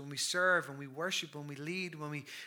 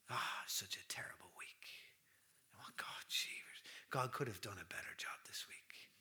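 grumbling under the breath in a whisper; whispered complaints of a reluctant heart; reluctant grumble; 8 semitones lower, 11 dB quieter than usual. Pretend. Worshipper grumbling to God about a bad week, whispered.

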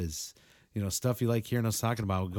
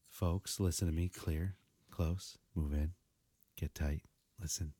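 mock intimate hush; 5 semitones lower, 6 dB quieter, slower than usual. Host beckoning the podcast audience closer, hushed and intimate. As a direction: lower and softer, drawing listeners close. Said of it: "What's wrong with you?".

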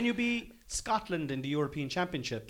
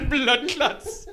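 high, laughing mock Jamaican accent; Caribbean accent; 11 semitones higher, 6 dB louder than usual. Co-host echoing the joke, laughing Caribbean accent. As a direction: crack up doing a Jamaican accent. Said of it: "very Caribbean towards the end".

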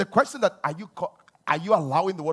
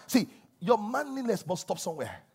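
ordinary talk

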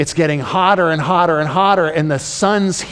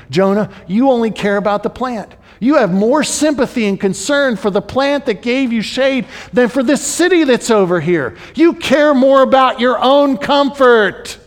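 higher and louder, building rebuke; rising rebuke; 8 semitones higher, 5 dB louder than usual. Acted. Preacher rebuking Jonah for his self-pity, building louder. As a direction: drive it home louder each line.

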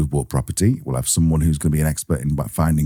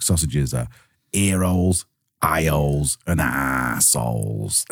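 put-on a rough Manchester accent, mostly in the own voice; half-hearted go at a Manchester accent, the last word slowed; 3 semitones lower, 4 dB quieter, slower than usual. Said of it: "I can't really do a mank accent".

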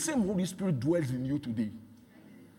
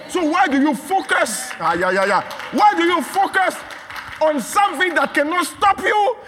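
loud, high, fervent preaching; preaching at full force; 11 semitones higher, 7 dB louder than usual. Acted. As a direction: hammer it home to the congregation.

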